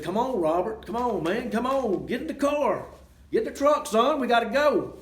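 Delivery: urgent calling out. Retold or imitated. Yelling to Robert to get in the truck, urgent. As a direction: hurry him along, climbing in pitch. Yelling to friend fighting dog.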